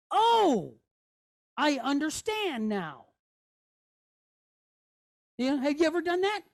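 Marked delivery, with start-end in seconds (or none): 0.1-3.1 dawning realisation. Acted. Teacher voices the dotted letter having an idea, dawning realisation. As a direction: act the idea landing, stretching the words. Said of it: "it's like he's having an idea".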